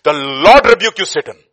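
loud, slow thundering rebuke; Lord's rebuke. Acted. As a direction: thunder it at Satan, swelling on "Lord". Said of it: "you know what the Lord says?".

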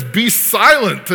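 loud, high, drawn-out order; powerful command. Acted. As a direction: silence them with one slow, forceful word. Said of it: "that kind of powerful word".

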